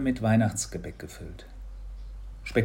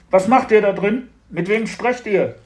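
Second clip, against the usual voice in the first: loud, raised questioning; father calling questions; 10 semitones higher, 9 dB louder than usual. A narrator's reading. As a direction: question the children through a closed door. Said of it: "ertönte Papas Stimme hinter der Tür".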